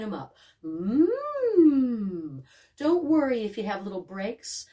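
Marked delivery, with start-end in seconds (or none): 0.6-2.4 up-and-down hum. Shown as a demonstration. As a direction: hum gliding up then back down. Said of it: "just waking 'em up".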